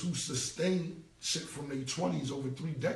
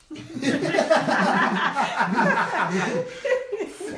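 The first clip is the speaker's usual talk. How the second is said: big burst of real laughter, much louder; 12 semitones higher, 11 dB louder than usual. Real, not acted. Amused at his emotional friend, big loud laugh. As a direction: laugh out hard at the memory.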